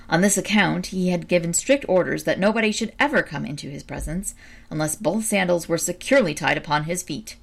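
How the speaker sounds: ordinary delivery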